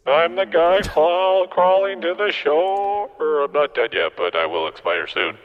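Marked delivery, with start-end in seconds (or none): 0.1-3.9 very high, slow, creepy ghost. Pretend. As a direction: stretch it out high and eerie. The other ghost talking.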